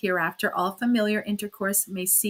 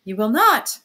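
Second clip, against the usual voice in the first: louder, delighted disbelief; light up with relief; delighted cry; 3 semitones higher, 9 dB louder than usual. Acted. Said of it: "cried she delightedly".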